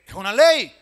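loud, fervent sermon voice; preaching at full force. Acted. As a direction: proclaim it to the whole church. Pastor preaching loudly to congregation. (predicando a todo pulmón)

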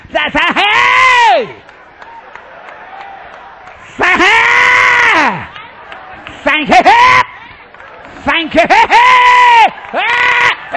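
high, loud stuttering whoops of praise; ecstatic shouting. Retold or imitated. Lady breaking through in praise, imitated ecstatic shouts. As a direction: break into whoops between thanks. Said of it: "started shouting and dancing".

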